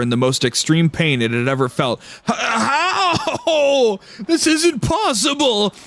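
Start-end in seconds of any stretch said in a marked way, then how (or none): 2.3-5.7 high, strained voice in agony; pained being. Acted. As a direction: squeal through the pain. Being in extreme pain.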